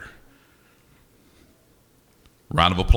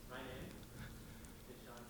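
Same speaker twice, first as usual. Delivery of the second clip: usual speaking voice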